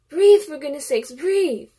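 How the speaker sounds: loud, high urgent order to breathe; urgent command. A narrator's reading. Voice ordering the panicking narrator to breathe, loud and urgent. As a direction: snap her out of the panic.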